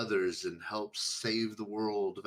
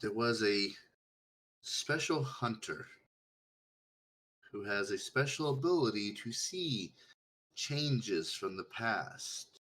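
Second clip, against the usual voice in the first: put-on reading to children; 2 semitones lower than usual. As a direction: tell it like a bedtime story. Storytelling to simple character.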